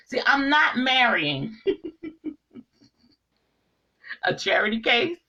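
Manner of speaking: real laugh breaking through the words. Real, not acted. Telling viewers about the suitor, a laugh bursts through. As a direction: laugh out the words as you say them. Laughing at her own punchline.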